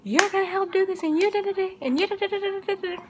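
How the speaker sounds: high, ranting tirade; going off. Retold or imitated. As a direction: rant at the boyfriend nonstop. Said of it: "she was going off the dome".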